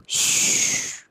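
soft breathy snore hiss; shushing snore sound. Acted. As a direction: hiss like a sleeping tadpole's snore. Snoring sound in story.

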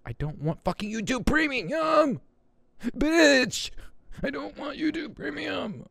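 high-pitched whiny protest; mock tantrum. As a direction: whine it high, like a thwarted child. Mock tantrum over YouTube Premium.

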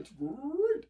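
high cartoon-mascot catchphrase; Tony the Tiger. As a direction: stretch "great" upward like a cereal mascot. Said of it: "You got that Tony the Tiger".

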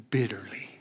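breathy whisper of grief. A narrator's reading. The preacher telling of Peter's weeping, whispered. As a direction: let the word fall away in a whisper.

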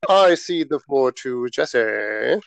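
drawn-out pompous delivery, x0.5; slow, grand handover of the floor; slow formal. Pretend. Mock formal meeting.

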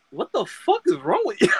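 high-pitched disbelief breaking into a laugh; appalled question with a laugh in it; incredulous. Retold or imitated. Podcaster retelling his reaction to the cheering crowd, incredulous.

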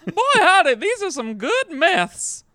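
Gary character voice. Acted. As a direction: whoop it out like a cheerful dealer. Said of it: "It's Gary".

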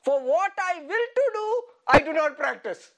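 playfully exasperated, pitch up at the start; acted frustration. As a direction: read it as a mock-frustrated outburst. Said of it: "Second frustration".

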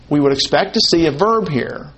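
ordinary talk, nothing marked

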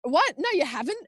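high, loud incredulous what; mock disbelief. Midwives doubting the mother, high mock disbelief.

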